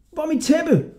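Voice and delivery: high, alarmed shout; startled cry. A narrator's reading. Hodja waking up to find his carpet gone, alarmed. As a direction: cry out in sudden fright. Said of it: "råbte han og sprang forskrækket op".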